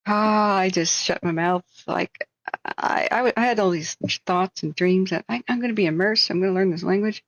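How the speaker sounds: ordinary delivery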